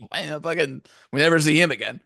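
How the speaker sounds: ordinary talk, nothing marked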